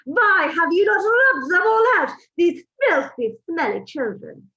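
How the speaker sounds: high, loud, slow scornful screech; the Grand High Witch berating her audience, yelling in fury; furious witch yelling. A narrator's reading. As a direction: scold the witches like vermin. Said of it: "she screamed".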